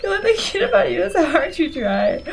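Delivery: high-pitched, giggly read-aloud; real laughter breaking into the lines; laughing while reading. Real, not acted. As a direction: struggle to read through the giggles. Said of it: "I know I shouldn't laugh".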